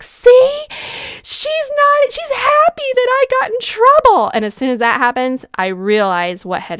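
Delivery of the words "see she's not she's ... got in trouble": put-on very high, loud child voice; mimicking her kid. Mother imitating her child calling her out, very high kid voice. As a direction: squeak it out like a tattling kid. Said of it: "my kids will even call me out on it and be like".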